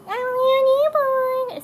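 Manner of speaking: put-on very high, loud, stretched-out baby voice; baby's voice. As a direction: squeal it slowly as a tiny baby. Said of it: "that's my four-month-old's voice".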